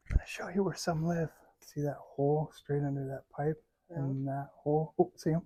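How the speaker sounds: quiet sneaking voice; hushed voice. Real, not acted. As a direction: keep it down so they stay. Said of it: "Talk quieter".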